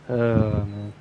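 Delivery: ordinary delivery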